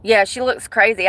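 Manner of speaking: ordinary talk, nothing marked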